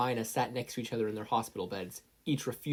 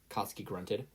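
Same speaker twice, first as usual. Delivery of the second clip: usual speaking voice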